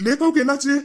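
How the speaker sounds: sudden high alarmed question. Acted. Brother hears his sister is starving, alarmed. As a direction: jolt up in pitch with worry.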